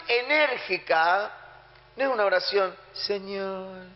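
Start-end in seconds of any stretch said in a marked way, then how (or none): 2.9-3.9 soft, faint, half-hearted pleading prayer; mock weak prayer. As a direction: pray limply with no energy, mocking it. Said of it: "No se refiere a ese tipo de oraciones". (oración débil y sin energía, en tono de burla)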